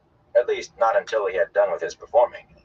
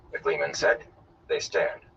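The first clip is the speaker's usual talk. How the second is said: ordinary delivery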